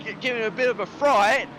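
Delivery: high, fast, excited race call; motorsport commentator. Real, not acted. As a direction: build the call as he heads for the wall. Live call of side-by-side fight.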